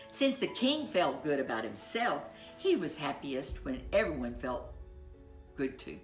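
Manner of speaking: ordinary delivery, nothing marked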